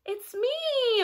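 high, drawn-out bleat; bleating middle goat. A narrator's reading. Middle goat answers the troll, bleating. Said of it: "Middle Gruff bleated".

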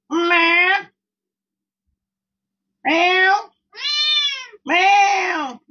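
high loud cat meow; playful high meow. Real, not acted. Meowing at the cat.

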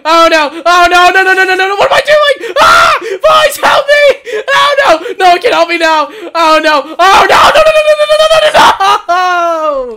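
high, loud desperate cries for help; cornered and alone, yelling to his team in panic; panicked screaming. Real, not acted. Losing fight alone, begging for help.

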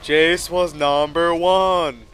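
loud, slow, high taunting chant; mocking taunt. Real, not acted. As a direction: drag it out to rub it in. Taunting the enemy after a kill.

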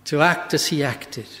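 ordinary delivery

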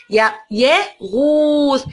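يغوص drawn very slowly; teacher sounding يغوص slowly. Shown as a demonstration. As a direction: hold each sound of يغوص. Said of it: "مد بالواو".

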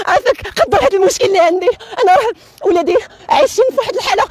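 high tearful woman begging; pleading woman. Acted. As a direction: beg high and near crying. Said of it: "أعطينا شي دور ديال شي مرأة سرقات الروز".

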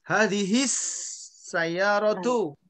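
slow "Hadihis sayyaratu", held s and long vowels; model Arabic reading. Shown as a demonstration. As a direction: draw out "Hadihis sayyaratu", lam silent. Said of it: "bacanya ini ya, perhatikan".

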